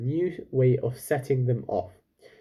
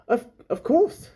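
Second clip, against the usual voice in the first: flustered high reply; 10 semitones higher, 4 dB louder than usual. A narrator's reading. Chulak answering, flustered.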